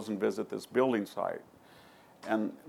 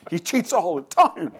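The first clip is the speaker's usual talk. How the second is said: loud, high, incredulous exclamation; exclaimed retold answer; 8 semitones higher, 9 dB louder than usual. Golfer tells the writer about Trump's cheating, exclaiming. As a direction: act out an emphatic golfer, pitched way up and loud.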